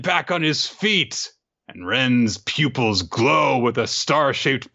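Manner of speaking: slow, high, epic storyteller delivery; dramatic narration. A narrator's reading. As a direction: draw it out like a hammed-up climax.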